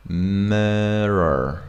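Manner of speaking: MIRROR drawn out into a long R. Shown as a demonstration. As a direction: stretch the end of MIRROR into one held R. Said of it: "it's like a long R sound".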